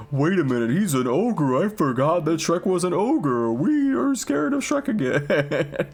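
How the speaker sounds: mock shocked crowd. Townspeople realising Shrek is an ogre, hammed-up alarm. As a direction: ham up a crowd suddenly scared of an ogre. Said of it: "people remembered that, like".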